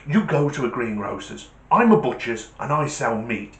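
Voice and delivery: normal talking voice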